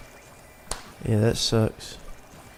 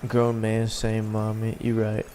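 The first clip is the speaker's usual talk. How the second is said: ordinary talk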